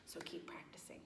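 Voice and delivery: soft whisper of encouragement. Real, not acted. Teacher to kids, whispering a last tip. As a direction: lean in and whisper it.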